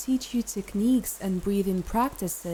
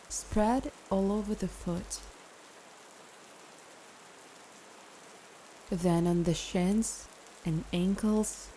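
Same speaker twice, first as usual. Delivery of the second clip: calm meditation guide with long pauses; guided meditation; 6 dB quieter than usual. Acted. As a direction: ease the listener into rest, phrase by phrase.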